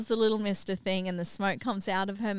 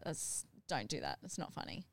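hushed scolding; 5 semitones lower, 13 dB quieter than usual. Retold or imitated. Mum hushes her daughter's prank, hushed and low. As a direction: drop to a hushed, low warning.

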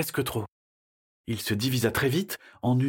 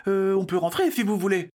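put-on high, timid child's voice up close; timid child, no echo; 7 semitones higher than usual. Boy suggesting they turn back, high timid voice. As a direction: suggest it nervously, high and clear. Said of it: "Un écho s'empara de ces derniers mots".

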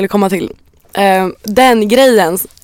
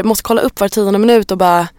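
ordinary talk, nothing marked

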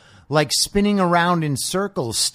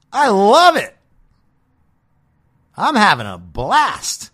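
excited; 5 semitones higher, 6 dB louder than usual. Real, not acted.

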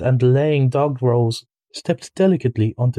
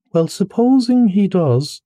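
higher, slow coaxing plea; pleading mole; 7 semitones higher, 3 dB louder than usual. A narrator's reading. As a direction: coax gently, drawing it out. Mole presses the rat.

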